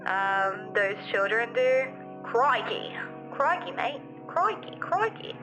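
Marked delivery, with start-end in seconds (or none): none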